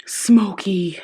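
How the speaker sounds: lightly put-on sultry voice; a hint of smoky seduction; smoky bedroom voice. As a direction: ease into a playful bedroom voice. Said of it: "I don't know why I did that".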